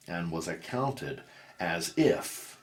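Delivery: ordinary delivery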